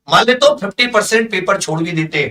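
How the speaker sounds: ordinary talk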